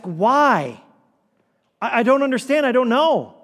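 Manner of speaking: impassioned questioning. Acted. Sufferer in the midst of grief asks why, impassioned. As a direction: cry it out as if pleading for answers.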